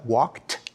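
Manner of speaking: WALKED with a crisp final t; "walked" pronounced "walkt"; ending like t. Shown as a demonstration. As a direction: let the ed in "walked" land as a released t. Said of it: "pronounce the E D like a t".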